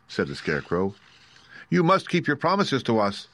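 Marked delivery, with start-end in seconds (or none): 1.7-3.3 put-on higher, insistent girl's demand; exclaiming. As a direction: exclaim it firmly. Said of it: "exclaimed Dorothy".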